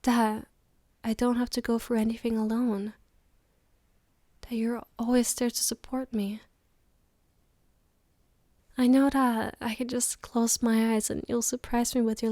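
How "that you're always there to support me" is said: whispering. Acted. Tender words to partner.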